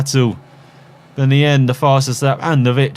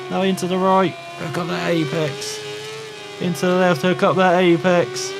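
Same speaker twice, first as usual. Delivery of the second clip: high, urgent play-by-play; excited race commentary; 9 semitones higher than usual. Acted. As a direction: call the race live, pitched high and building to the win.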